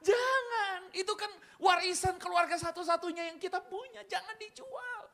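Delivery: hammed-up daughter's protest. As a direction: cry out like the shocked daughter. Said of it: "anak ini bilang".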